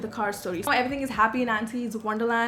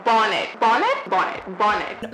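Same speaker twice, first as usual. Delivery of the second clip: mocking repetition; 4 dB louder, slower than usual. Mocking cop's repeated word.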